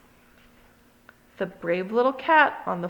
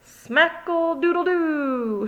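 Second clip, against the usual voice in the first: stretched-out higher cry; slow shout; 7 semitones higher, slower than usual. Acted. Kitty boy shouts as he crashes, slow shout. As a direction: call it out slowly while flying. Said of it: "shouted the kitty boy".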